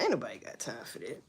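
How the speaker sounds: low, soft, fast brush-off; dismissive catchphrase. Real, not acted. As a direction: toss it off under your breath.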